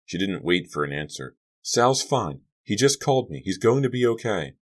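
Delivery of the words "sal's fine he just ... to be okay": put-on high-pitched girl's news; urgent reassurance. A narrator's reading. Sharon reports on Sal, high eager reassurance. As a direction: blurt out the good news.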